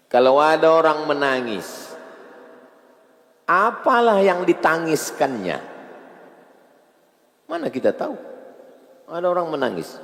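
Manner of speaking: high and loud, questioning the crowd; preaching at full force. Acted. Preacher asking the mosque crowd why people weep, loud and high. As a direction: press the congregation with each question.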